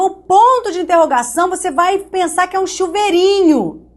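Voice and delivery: mock a sweet old teacher explaining a silly trick. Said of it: "Tia Maricléia falava".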